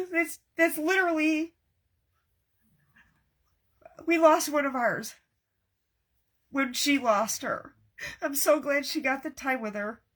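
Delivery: high, choked-up grief; blubbering. Real, not acted. Crafter mourning one of their own, blubbering. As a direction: talk through real tears and a weak laugh. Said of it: "I'm like a blubbering idiot".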